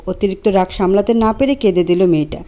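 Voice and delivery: ordinary delivery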